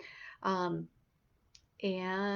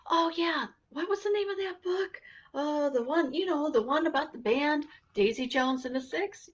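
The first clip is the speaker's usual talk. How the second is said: excited recall; 7 semitones higher than usual. Pretend. Imagined listener remembering a title.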